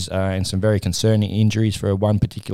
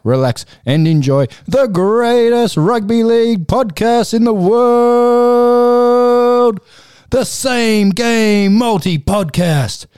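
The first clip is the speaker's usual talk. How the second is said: booming, slow, much higher and louder; ringmaster; 7 semitones higher, 4 dB louder, slower than usual. Acted. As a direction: roll up the crowd like a circus ringmaster. Podcast intro hype announcement.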